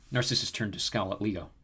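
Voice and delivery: usual speaking voice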